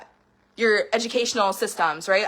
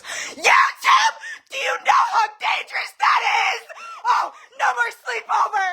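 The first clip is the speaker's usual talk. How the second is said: much higher, crying shriek of a sister outraged at YouTube; slower than usual. Retold or imitated. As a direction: shriek in over-the-top alarm, voice cracking. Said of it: "she's, like, outraged by YouTube".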